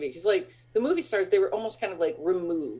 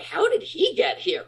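high-pitched retold astonishment; baffled disbelief; 12 semitones higher than usual. Friends on a remote beach spot a drinks seller, high incredulous question. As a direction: imitate being utterly baffled, pitch jumping up.

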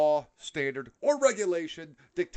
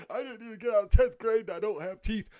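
higher, hammed-up toothless yokel voice; 10 semitones higher, 7 dB louder than usual. Mocking uneducated Southerners.

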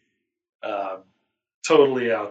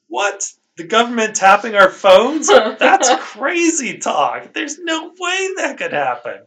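much higher, hammed-up outrage, rising to a shout; sarcastic disbelief; 10 semitones higher, 6 dB louder than usual. Host mocking the episode's plot to cohost, in sarcastic shock. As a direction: fake shock that the government would tap phones.

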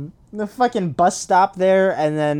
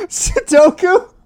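high, slow mock disbelief; incredulous; 12 semitones higher, 5 dB louder, much slower than usual. Real, not acted. Friend mocking the Sudoku slip, high slow disbelief. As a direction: repeat the word, baffled. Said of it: "You mean seppuku?".

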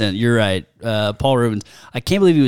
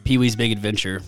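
normal talking voice

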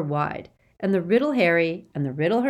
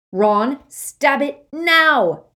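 loud, high, slow bellow; 9 semitones higher, 7 dB louder, much slower than usual. Acted. Harry bellows at Ron to stab it now. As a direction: roar it out, drawn and desperate. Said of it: "Harry bellowed".

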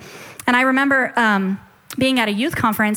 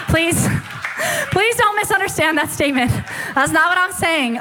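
higher-pitched, real embarrassed laughter; laughing plea; 5 semitones higher than usual. Real, not acted. Speaker begging the audience not to misunderstand, laughing plea. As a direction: plead through laughter.

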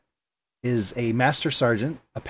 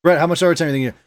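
normal talking voice

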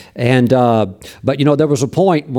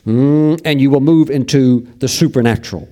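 preaching. Real, not acted. Preaching on praise.

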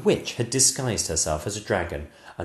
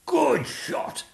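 mild cheering roar. Acted. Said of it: "roared the king".

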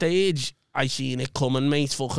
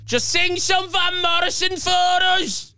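high, drawn-out heckler's yell; mock scream, pitched way up; screamed drunken heckle; 12 semitones higher, slower than usual. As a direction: screech it slowly as a drunk heckler. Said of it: "just screamed".

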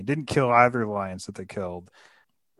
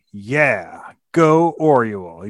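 loud, drawn-out cheer; root for her, punching it out; mock cheering; 9 dB louder, much slower than usual. Readers cheering on Orual as she stands up, rousing.

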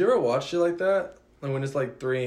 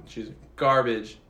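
ordinary talk

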